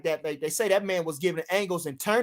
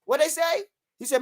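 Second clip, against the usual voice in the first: ordinary delivery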